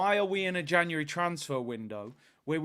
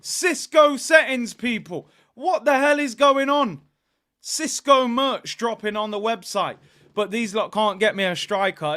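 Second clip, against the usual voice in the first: outraged; 5 semitones higher, 9 dB louder than usual. Real, not acted. Outraged at merch drop.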